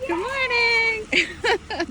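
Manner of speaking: high, slowly drawn-out good morning; sing-song greeting. Real, not acted. Mom greeting the bus driver at the door, high and sing-song. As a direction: stretch it out bright and high.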